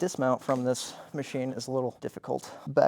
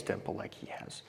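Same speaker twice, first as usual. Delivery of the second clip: whisper; 5 semitones lower, 12 dB quieter than usual. Acted. Whispered aside in parody.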